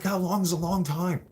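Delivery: high, loud frantic question; retold frantic outburst; panicked question. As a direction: beg for an answer in panic. Anxiety freak out in court.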